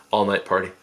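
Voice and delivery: ordinary talk, nothing marked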